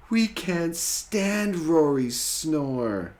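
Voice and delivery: high, slow and loud complaint; high whiny voice. Acted. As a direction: whine like little dinosaurs. Friends complain together.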